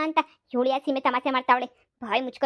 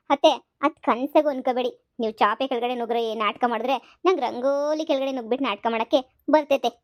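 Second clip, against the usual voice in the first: ordinary delivery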